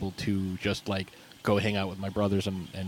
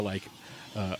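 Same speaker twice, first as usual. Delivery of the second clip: ordinary talk, nothing marked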